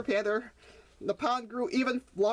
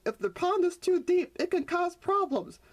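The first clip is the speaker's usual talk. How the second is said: high-pitched warning; 8 semitones higher than usual. Acted. Mother warning the children.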